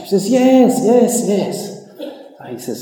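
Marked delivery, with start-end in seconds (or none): 0.3-1.9 high, loud smug yes. Acted. Bubba brushing off Jack's doubt, high and smug. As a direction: crow it back, cocky and pleased.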